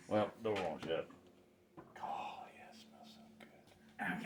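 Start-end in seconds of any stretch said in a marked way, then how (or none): 2.0-4.3 breathless whisper of delight. Real, not acted. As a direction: whisper it as if the smell overwhelms you. Savoring the smell of meat.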